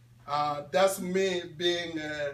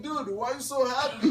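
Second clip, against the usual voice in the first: retold puzzlement; 7 semitones higher, 6 dB louder, faster than usual. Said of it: "I was like".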